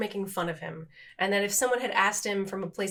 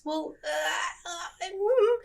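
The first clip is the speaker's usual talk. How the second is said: high, drawn-out noises of doubt; doubtful hemming; 8 semitones higher, much slower than usual. Real, not acted. Speaker unsure that intention matters, high doubtful hemming. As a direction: hem and haw, pitched way up.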